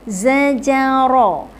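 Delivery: usual speaking voice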